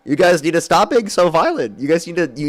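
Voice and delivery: loud, hammed-up rally speech; mock speechmaker. Debater parodying a supporter lecturing the crowd, mock speechmaker. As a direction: holler it like a parody stage speaker.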